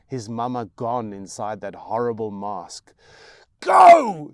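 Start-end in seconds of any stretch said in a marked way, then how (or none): 3.6-4.3 very loud, high scream. Acted. Mother screaming at her son to leave, full scream. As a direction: scream him out the door. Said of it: "she screams at him".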